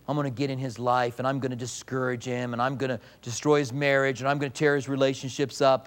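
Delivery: low, quiet scheming devil voice; lightly voiced menacing Satan, low and quiet; Satan boasting. Satan voiced threatening in sermon.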